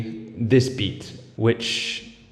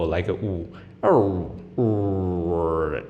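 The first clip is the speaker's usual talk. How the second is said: closed ooh; 4 semitones lower, 3 dB quieter, much slower than usual. Shown as a demonstration. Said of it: "the O".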